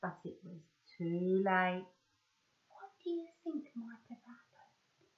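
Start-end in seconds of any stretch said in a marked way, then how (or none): 2.7-5.1 hushed suspenseful question; whisper. Real, not acted. Storyteller asking the children what happened, whispered.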